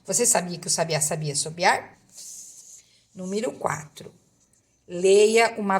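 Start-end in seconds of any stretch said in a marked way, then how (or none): 0.0-2.0 trava-língua dito bem rápido; fast tongue twister. Shown as a demonstration. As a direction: rattle off "Você sabia que o sabiá sabia sobiar?" quickly. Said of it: "você pode falar bem rápido aí o trava-língua".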